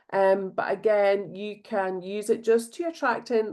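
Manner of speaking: normal talking voice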